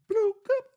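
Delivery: high squeaky bloop bloop; mock pop sound. Mimicking cherries popping into mouths, squeaky pop noise.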